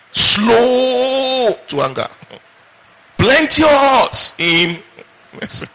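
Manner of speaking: drawn-out proclamation. Acted. Preacher proclaiming God's nature to the congregation, slow and drawn-out.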